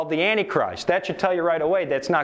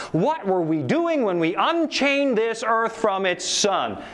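higher, rising lament of questions; dramatic reading; 5 semitones higher than usual. Acted. Preacher reading the madman's lament, rising despair.

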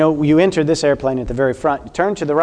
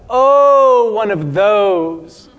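a high, loud "Oh", then a slow, drawn "one of those"; dismissive; 5 semitones higher, 4 dB louder, much slower than usual. Retold or imitated. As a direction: sneer at the denomination, drawing out "those". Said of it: "He was like".

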